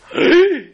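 sharp high loud gasp; a sudden crowd-like gasp of disbelief; stunned gasp at the guilty verdict; shocked gasp. Acted. Courtroom reacts to verdict.

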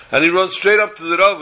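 ordinary talk, nothing marked

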